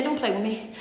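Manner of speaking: hushed warning. Acted. Jesus voiced, low and hushed.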